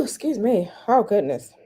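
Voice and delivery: ordinary talk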